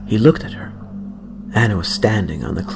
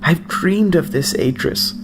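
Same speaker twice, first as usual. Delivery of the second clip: higher, slower dreamy grandmother; wistful grandmother; 8 semitones higher, slower than usual. Acted. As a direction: speak of a long-held dream.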